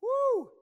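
a huge high whoop at full volume; exultant woo. Real, not acted. Preacher whoops to the congregation after the Holy of Holies. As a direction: let out a real shout of joy.

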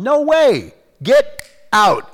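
high, loud, forceful rebuke; commanding refusal. Acted. Preacher refusing what Satan brings on, commanding shout. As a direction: throw the intruder out with authority.

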